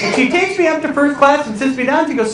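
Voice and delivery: usual speaking voice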